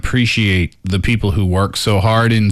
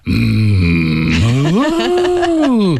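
long moo swooping very high; dramatic moo; 5 semitones higher than usual. Shown as a demonstration. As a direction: moo like a melodramatic cow. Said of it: "you can be very dramatic with your animal sounds".

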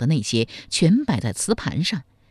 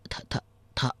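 whispered, broken stammer; stammering in shock; 3 semitones lower, 9 dB quieter, faster than usual. A narrator's reading. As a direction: choke on the word in a soft whisper. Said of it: "机不成语地说".

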